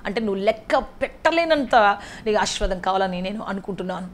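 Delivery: ordinary delivery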